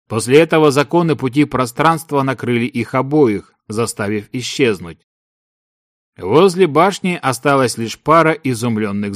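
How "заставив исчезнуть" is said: usual speaking voice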